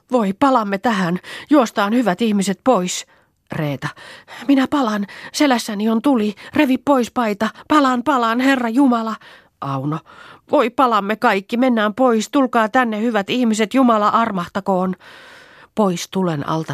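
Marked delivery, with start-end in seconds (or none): 0.1-3.1 high, alarmed plea; acted alarm, pitch raised; panicked cry. A narrator's reading. 4.5-9.2 high-pitched frantic cries; acted terror, voice pitched up; burning panic. A narrator's reading. Character is on fire. 10.5-15.0 high, urgent cries for escape; acted fright, sustained raised pitch; panicked pleading. A narrator's reading.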